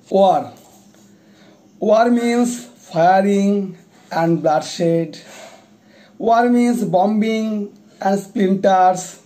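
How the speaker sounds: solemn poetry reading with long pauses between phrases; poem recitation. Acted. Recites own anti-war poem.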